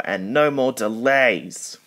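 stern scolding. A narrator's reading.

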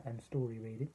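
ordinary delivery, nothing marked

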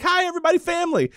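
lightly voiced, high-pitched character; mock cheery greeter. Mia welcoming guests to the family, high mock greeting. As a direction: chirp a high, hammed-up welcome.

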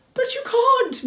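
high, loud distressed protest; very upset cry. A narrator's reading. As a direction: cry out in shocked objection. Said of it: "Moomintroll cried, very upset".

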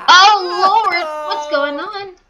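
loud, high shout of alarm; high-pitched startled yell; alarmed cry. Real, not acted. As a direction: cry out as chaos breaks loose. Alarmed by sudden game fight.

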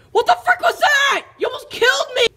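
high, loud furious yell; outraged shriek after nearly getting hit; angry shout. Acted. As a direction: scream it at your brother. Brother nearly hit by box.

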